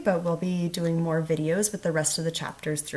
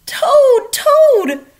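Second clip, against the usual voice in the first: much higher, loud calls; crying out; 15 dB louder than usual. A narrator's reading. Frog crying out to Toad inside, loud high calls. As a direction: cry his name at the door. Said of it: "cried Frog".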